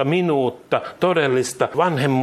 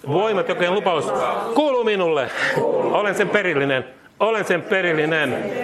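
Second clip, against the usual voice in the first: higher-pitched, measured proclamation in short phrases; leading a declaration; 4 semitones higher than usual. Real, not acted. Preacher leading believers to repeat "sano näin", declaring. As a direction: proclaim each line for others to echo.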